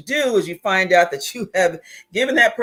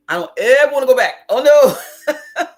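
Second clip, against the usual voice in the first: loud, high-pitched outburst breaking into a laugh; laughing, emphatic protest; emphatic refusal; 7 semitones higher, 9 dB louder than usual. Real, not acted. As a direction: refuse it loudly, pitch jumping up. Emphatic refusal of going back.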